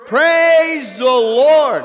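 slow, stretched loud praise shout; demo shout. Shown as a demonstration. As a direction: show them how to shout it. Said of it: "Let's do it".

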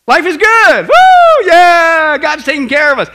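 loud, high whooping celebration; exuberant cheering. Acted. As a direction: whoop and shout it with full joy. Preacher cheering that life is good.

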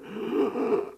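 long acted gasp; dramatic mock choking breath; imitated last breath. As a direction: mimic a man straining for air. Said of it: "took my last breath and I went".

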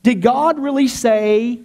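slow, loud, drawn-out challenge; tempting devil. Acted. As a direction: imitate the devil sowing doubt, stretching the words. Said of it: "tactic the devil used".

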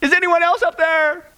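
acted panicked yell. Tourist yells to heaven for someone else, acted panic. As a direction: call out in hammed-up alarm, pitched very high. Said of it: "the tourist yelled".

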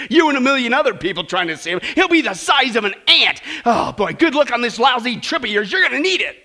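loud, high, sarcastic good-luck; scornful hairdresser. Retold or imitated. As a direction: wish her luck you don't mean. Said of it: "laughed the hairdresser".

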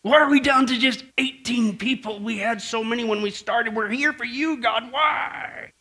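high, drawn-out whine to heaven; mock wailing complaint. Pilgrims questioning God over their losses, mock wailing. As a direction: cry why as a hammed-up complainer. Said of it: "shook a fist at God".

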